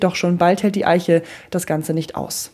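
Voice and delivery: usual speaking voice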